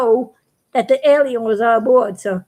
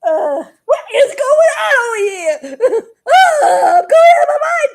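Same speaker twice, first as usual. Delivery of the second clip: much higher and louder, laughing yell; freaked-out Big Stuff; 12 semitones higher, 5 dB louder than usual. Retold or imitated. Astronaut thinks he's losing his mind, squeaky laughing yell. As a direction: panic in a squeaky cartoon shout. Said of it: "And he's like".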